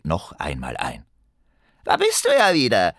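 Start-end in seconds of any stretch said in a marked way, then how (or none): 1.8-2.9 high goblin voice, greeting; voz de duende. A narrator's reading. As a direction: pipe up like a gleeful little goblin. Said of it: "meldete sich erneut die fremde Stimme von eben".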